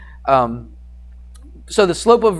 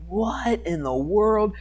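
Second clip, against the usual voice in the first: slow, drawn-out disbelief; stunned, stretched-out reaction; astonished retelling; 6 semitones higher, 7 dB quieter, much slower than usual. Retold or imitated. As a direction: draw it out in mock amazement.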